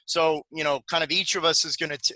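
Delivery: ordinary talk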